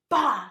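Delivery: sudden, drawn-out "bah" bang; imitated door slam. Telling how girlfriend stormed off, imitated door slam.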